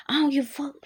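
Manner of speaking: a quick unvoiced whisper inside the quote; hushed mock whisper; whispered aside. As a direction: drop to a whisper for this bit. Baby daddy quoted mid-argument.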